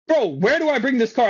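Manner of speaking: exasperated question, pitched well up; getting mad. Retold or imitated. Valet who gets no directions from staff, getting mad. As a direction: ask it with your patience running out. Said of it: "I started getting mad".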